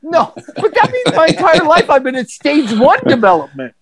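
high-pitched mock dismay; retold dismayed protest. Patient told he is at stage two, high dismayed protest. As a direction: protest it high, as if mildly outraged. Acted protest to his therapist.

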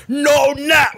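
high, loud mock cry; imagined Brian protesting. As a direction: shout it up high as a pretend child. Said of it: "imagine Brian be like".

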